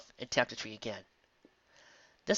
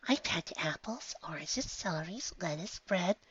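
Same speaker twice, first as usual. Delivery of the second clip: higher, softer, slow, fading to a whisper; timid soft voice; 5 semitones higher, 7 dB quieter than usual. Acted. Fluttershy listing her packed food, timid and soft. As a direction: shyly list the food. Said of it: "said Fluttershy".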